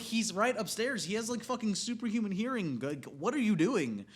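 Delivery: high, loud, exasperated. Retold or imitated. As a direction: yell at her in disbelief. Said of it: "And I'm like".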